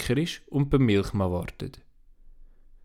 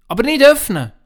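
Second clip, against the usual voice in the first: put-on a much higher, louder child's voice; sick girl insisting it stays shut; girl Antonia; 8 dB louder than usual. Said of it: "sagt Antonia".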